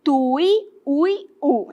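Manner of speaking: "Tui, ui, u" in separate, deliberate syllables; measured demonstration of "Tui, ui, u"; slow careful pronunciation. Shown as a demonstration. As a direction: pronounce "Tui, ui, u" slowly to get it right. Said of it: "Vou falar devagar".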